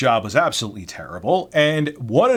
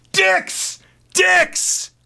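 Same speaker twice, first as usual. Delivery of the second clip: much higher, louder shouted name; mock scream; 4 dB louder than usual. Coworker screaming the nickname through the warehouse, high yell. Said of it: "screaming in the warehouse".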